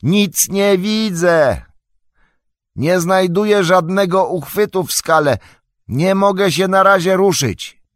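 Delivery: high, loud strained call; stretched, high-pitched distressed yell; shouting for help. A narrator's reading. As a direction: shout up to a friend far above. Shouting up from ledge, blinded.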